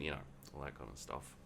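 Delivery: ordinary talk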